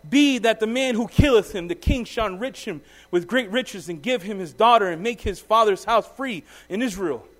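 measured Bible reading, falling to a hush; solemn scripture reading. Acted. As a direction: read the passage like a preacher at the lectern. Reads scripture aloud.

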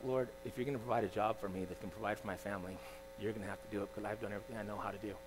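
hushed, low plea to God; retold tearful prayer. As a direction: pray wearily, voice sunk very soft. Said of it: "I literally was in tears in my office, um, praying".

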